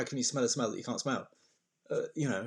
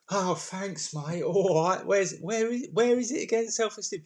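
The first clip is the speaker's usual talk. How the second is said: higher, puzzled questioning voice; bewildered, pitched up; mock confused reply; 7 semitones higher than usual. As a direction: play it baffled and searching.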